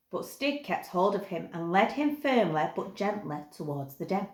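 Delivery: usual speaking voice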